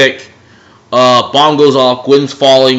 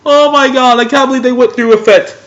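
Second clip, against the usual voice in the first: very high, stunned disbelief; shocked disbelief; 12 semitones higher, 3 dB louder than usual. Retold or imitated. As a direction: sound like you cannot believe what just happened.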